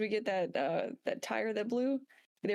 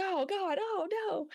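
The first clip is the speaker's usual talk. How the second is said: higher, dismayed groan; hammed-up disgust, much higher; mock horror; 11 semitones higher, slower than usual. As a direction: recoil at the sight, pitched up. Reacting to friend squatting.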